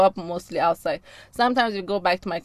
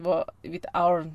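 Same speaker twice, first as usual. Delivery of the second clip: ordinary talk, nothing marked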